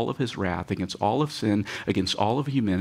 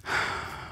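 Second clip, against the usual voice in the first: soft, unvoiced deep sigh; long sigh; 7 dB quieter than usual. Shown as a demonstration. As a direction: let all the air out. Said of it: "the literal translation of that is".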